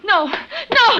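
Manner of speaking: high panicked no, no; fleeing in panic; terrified cry. Acted. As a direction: scream it in terror. Frida flees in terror.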